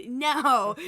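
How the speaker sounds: sharp loud no; loud protest. Real, not acted. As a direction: shout the no in protest. Protesting friend's plan.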